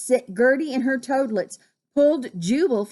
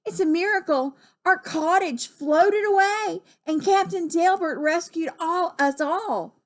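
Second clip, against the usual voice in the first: higher, mildly excited telling; amazed relief; 8 semitones higher than usual. Acted. Gertie telling Jubal of the captain's rescue, excited. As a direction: pitch up with wonder.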